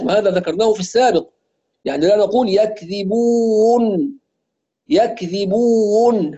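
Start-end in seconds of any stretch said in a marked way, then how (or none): 2.5-6.4 يكذبون with u swelling into extra w-u before n; stretched waw. Retold or imitated. Teacher mimics some people's error, stretched waw. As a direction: drag and re-round the waw in يكذبون. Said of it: "يمط في الواوات وفي الياءات".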